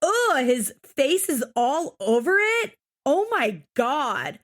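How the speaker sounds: slow, exaggerated swoops of horror; imitating Amber. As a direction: mimic a grossed-out teenager's whine. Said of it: "she's like".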